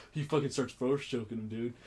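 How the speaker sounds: ordinary delivery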